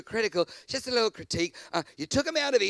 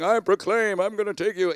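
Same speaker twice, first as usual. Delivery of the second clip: louder, grand proclaiming voice; mock God proclaiming; 6 dB louder than usual. Imagining God's big promise to Israel, mock grand. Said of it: "you're proclaiming".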